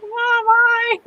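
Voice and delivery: high-pitched hello to mom; imagined call to mom. Pretend. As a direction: greet your mom in a high, happy squeal. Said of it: "talk to her and be like".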